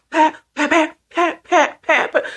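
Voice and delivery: high, rapid-fire pat noises; imitated slaps. Toy slap boxing mom, imitated smacks. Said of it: "starts slap boxing mom".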